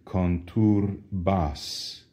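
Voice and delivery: "Conturbas" said slowly, syllable by syllable. Shown as a demonstration. Teacher slowly breaking down the verb. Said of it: "the last two syllables".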